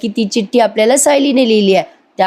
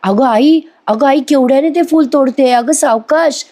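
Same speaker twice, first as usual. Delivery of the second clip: mock cry out; 5 semitones higher than usual. A narrator's reading. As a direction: cry out in protest, kept to storytelling volume. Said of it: "ती जोरात ओरडते". (घाबरून ओरडल्याची हलकी नक्कल)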